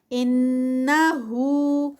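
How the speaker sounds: انہو with nasal ghunna on نّ; slow chant; tajweed recitation. Shown as a demonstration. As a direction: hold ghunna two counts. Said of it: "ان پہ غنہ ہے".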